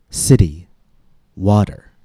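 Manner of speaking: "city" and "water" with each T like a quick, light D; tapped T. Shown as a demonstration. Teacher listing examples of American English, tapped T in "city" and "water". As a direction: tap the T in "city" and "water", one word at a time. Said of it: "The tap is very common".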